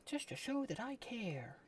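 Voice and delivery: mock sweet voice. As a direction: sweeten it up like a fake greeting card. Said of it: "my cryptic messages".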